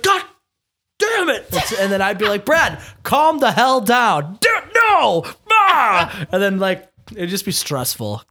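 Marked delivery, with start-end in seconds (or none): 0.0-1.5 high, hammed-up frustrated shout; mock the brother losing it; imitating frustrated outburst. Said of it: "my brother gets really frustrated". 2.5-6.3 exasperated telling-off. Retold or imitated. Calming frustrated brother in challenge.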